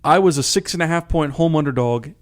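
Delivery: ordinary talk, nothing marked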